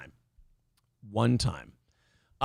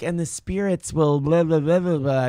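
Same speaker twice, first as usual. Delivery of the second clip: mock hippie woman. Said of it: "the older woman was like".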